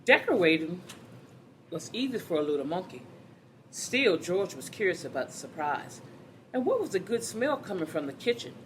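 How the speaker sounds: lively, animated storybook reading; reading to children. Acted. Teacher reading a picture book to the class, animated. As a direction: read it out to a class of kids with bounce.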